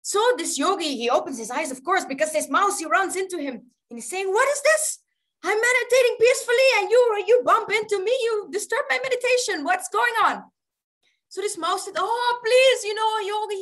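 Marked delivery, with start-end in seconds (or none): 4.3-10.5 higher, louder, annoyed outburst; indignant yogi. Acted. Yogi to the mouse who bumped into him, indignant. 12.0-13.6 pleading mouse. Acted. Mouse begging the yogi to save it from a cat, pleading.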